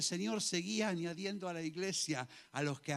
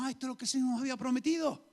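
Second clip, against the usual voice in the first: higher, louder and fast, brushing it off; relieved calm reply; 9 semitones higher, 8 dB louder, faster than usual. Pretend. Believers voicing untroubled relief at prophecy fulfilled. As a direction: sound unbothered, it's all expected.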